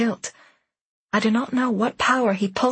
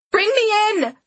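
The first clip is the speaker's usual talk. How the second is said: called out high and louder; urgent call; 12 semitones higher, 4 dB louder than usual. Acted. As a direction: shout it out in alarm. Shouting to the driver in danger.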